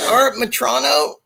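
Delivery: high, put-on voice asking for Art; mock timid phone caller; imitating own nervous self. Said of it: "I was like".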